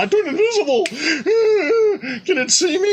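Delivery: high, laughing gleeful player voice. Retold or imitated. Player gloating to the table after turning invisible, laughing high. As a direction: giggle it out, pleased with the trick. Player boasting mid-chase.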